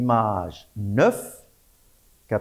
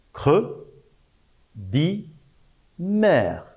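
isolated syllables with clear pauses; syllabe par syllabe; 4 semitones higher, much slower than usual. Shown as a demonstration. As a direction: dictate each syllable on its own. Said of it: "La troisième syllabe". (syllabe par syllabe, bien détachées)

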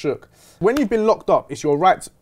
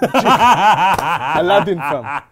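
laughing; 8 dB louder, much slower than usual. Real, not acted. Laughing at friend's carpet joke.

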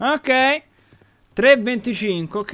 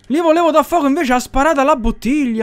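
loud, high exasperated outburst; angry cursing; 9 semitones higher, 6 dB louder than usual. Real, not acted. Gamer cursing his misfired bottle, loud and exasperated. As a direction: curse at the top of a raised voice. Cursing after the botched firebomb.

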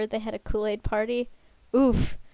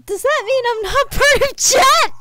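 high, loud shriek of protest; hammed-up indignant yell; mock outrage; 14 semitones higher, 11 dB louder than usual. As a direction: cry out as if left out. Mock protest at being left out.

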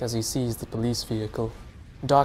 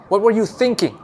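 loud, high angry shout; 10 semitones higher, 7 dB louder than usual. A narrator's reading. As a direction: yell at him. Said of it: "the officer shouts".

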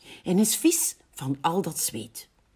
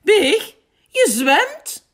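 very high, loud exclamation; calling out; 11 dB louder, much slower than usual. A narrator's reading. Schaap sees Big swimming in the bath, excited calling out. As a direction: cry out in amazed discovery. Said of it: "roept Schaap".

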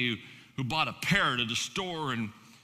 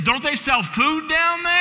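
higher, louder squawky parrot complaint; parrot's voice; 13 semitones higher, 10 dB louder, slower than usual. Retold or imitated. A preacher voicing a complaining parrot, higher and louder. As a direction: play the parrot griping about food. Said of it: "said the parrot".

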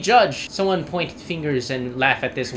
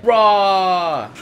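loud frustrated roar; 5 semitones higher, 7 dB louder than usual. Real, not acted. Frustrated at boss.

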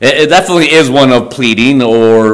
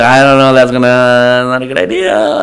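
doubtful questioning. Retold or imitated. Brothers balking at Joseph's demand, doubtful. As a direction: drag out the words as if unsure. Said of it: "And they question".

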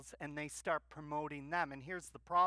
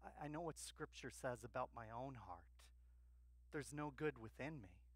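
self-righteous listener; 2 semitones lower, 10 dB quieter, faster than usual. Retold or imitated. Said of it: "You go".

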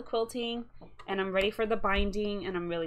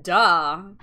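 loud, exaggerated duh; mock duh; 3 semitones lower, 14 dB louder than usual. Retelling her reaction to her boyfriend, a loud mocking duh. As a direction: throw out a big sarcastic duh. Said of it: "and then I was like".

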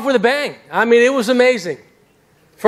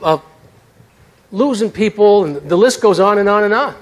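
normal talking voice

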